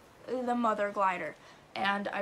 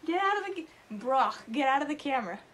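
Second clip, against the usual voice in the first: shouting at the dog; 5 semitones higher, 5 dB louder than usual. Real, not acted. Telling Brock to leave shot.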